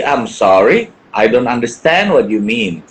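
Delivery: mock polite incomprehension; playing dumb. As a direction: feign confusion like Haji Bolot. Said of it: "dengan gaya bolot aja".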